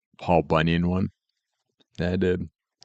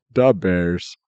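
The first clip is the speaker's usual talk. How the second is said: a touch of broad Chicago accent, a bit louder; 4 dB louder than usual. Shown as a demonstration. Joking about Chicago sports in a light Chicago accent. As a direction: punch it out like a Chicago sports fan. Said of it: "Pig Latin in a Chicago accent".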